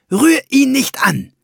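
high, loud furious snap; hissing snarl. A narrator's reading. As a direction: spit it at the greedy dwarf. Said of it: "fauchte sie".

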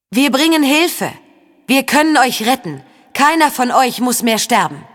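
high, insistent call of a persuading spirit; Stimme des Lügengeistes. A narrator's reading. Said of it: "wiederholte es dann mit der Stimme des Lügengeistes". (beschwörend hinaufrufen, mit hoher Stimme)

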